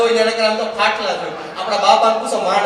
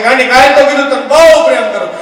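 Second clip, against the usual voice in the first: annoyed shout; 3 semitones higher, 7 dB louder than usual. Acted. Husband shouts on the phone.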